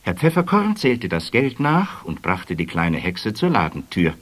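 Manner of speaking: ordinary delivery, nothing marked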